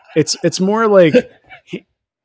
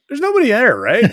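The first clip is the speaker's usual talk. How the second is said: much higher, puzzled; high mock Spock; 10 semitones higher than usual. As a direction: pitch it up as a baffled Spock. Voicing Spock.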